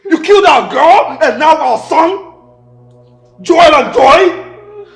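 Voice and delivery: slow, loud, high furious grilling; furious accusation. Acted. Husband confronting his wife over their dead children, furious. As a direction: hurl each question at her in rage.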